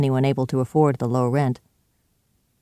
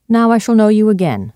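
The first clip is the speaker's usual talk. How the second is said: higher and louder, pointed; firm declaration; 7 semitones higher, 8 dB louder than usual. Acted. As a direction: fix him with the words. Utterson confronts Hyde face to face.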